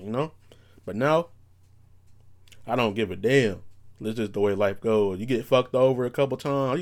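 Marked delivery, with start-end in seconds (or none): none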